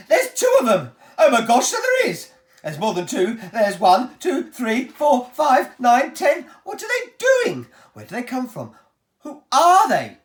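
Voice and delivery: high, loud excited counting; excited shouting. Acted. The crowd shouts at once seeing the tiny men, excited. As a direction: shout out in amazement. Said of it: "started shouting at once".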